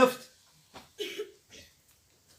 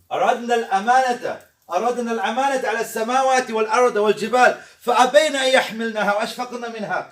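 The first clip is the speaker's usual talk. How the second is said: quick rhythmic recitation; Quran recitation; 4 dB louder, much faster than usual. Shown as a demonstration. A preacher quoting the verse in class, quick chanted recitation.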